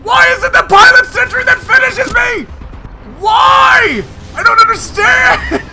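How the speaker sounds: high, loud outraged yelling; despairing shout. Real, not acted. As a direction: scream in disbelief at dying. Killed by the pilot sentry.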